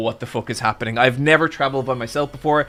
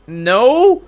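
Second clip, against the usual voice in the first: high, loud rising "no"; uneasy admission; 8 semitones higher, 7 dB louder than usual. Retold or imitated. Passenger answering the taxi driver's worrying question, uneasy high no. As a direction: voice shooting up into a nervous no.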